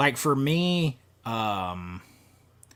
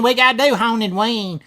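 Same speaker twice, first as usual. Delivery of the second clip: hammed-up Kentucky accent, louder and higher; mock the Kentucky host's accent, a bit louder; Kentucky guy impression; 7 semitones higher, 7 dB louder than usual. Telling chat about the Haunted Ween guy, mock Kentucky accent. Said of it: "He talks like this all the way through Haunted Ween, man, in Kentucky".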